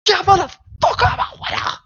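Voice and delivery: shrieking with glee at the win; triumphant yell. Acted. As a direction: crow like a champion. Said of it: "to not just be like".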